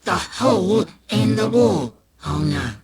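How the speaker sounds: usual speaking voice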